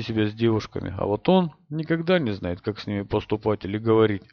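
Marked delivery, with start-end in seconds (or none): none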